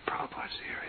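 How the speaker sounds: breathless retold whisper; whispering to himself, stunned that the guru has come; awed whisper. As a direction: hush the words as if in awe. Retold awe at Prabhupada's arrival.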